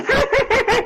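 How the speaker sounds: high, rapid witchy cackle; witch cackle. Retold or imitated. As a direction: cackle like a witch in a haunted house. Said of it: "Aunt Mary's in there with the makeup going".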